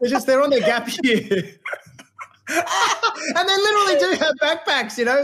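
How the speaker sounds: laughing through words. Real, not acted. Joking, laughing at own joke.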